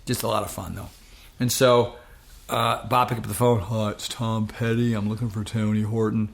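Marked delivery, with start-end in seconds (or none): 3.6-6.3 imitated musician's Gainesville accent; [name]. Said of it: "best I can do is my Gainesville accent".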